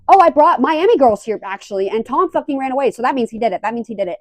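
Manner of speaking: acted-out triumphant accusation, loud burst easing off; worked-up outburst. Vlogger retelling Kristen's confrontation, worked-up outburst. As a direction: blurt it high and loud, then settle.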